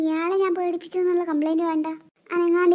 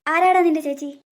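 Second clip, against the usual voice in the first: louder offended retort; indignant snap; 4 dB louder than usual. Acted. Wife angrily correcting the thief, louder. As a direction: bite back at being called sister.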